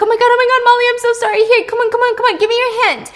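panicked, hurrying a friend out; frantic panic. Retold or imitated. Noah frantically freeing Molly from captivity, panicked and loud.

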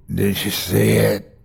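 low, wet gurgled question. A narrator's reading. Injured Terry to narrator, gurgled. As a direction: gurgle it out, badly hurt. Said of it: "he gurgled".

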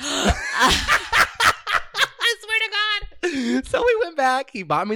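high squealing laugh through the words; laughing hard. Real, not acted. Telling the podcast host, laughing hard.

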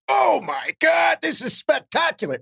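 high, drawn-out mock shriek of delight; gleeful exclamation. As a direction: crow it slowly, way up high. Gleeful friend's reaction retold.

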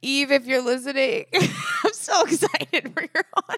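giggling high and bright; laughing. Real, not acted. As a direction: laugh through the shout-out. Laughing shout-out to instructor.

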